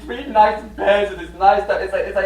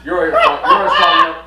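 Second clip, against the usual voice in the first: put-on loud, higher old man bluster; mock old man; 4 semitones higher, 9 dB louder than usual. Disguised old man ordering a strong young man, loud. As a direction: boss the young man around, loudly. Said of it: "he's like".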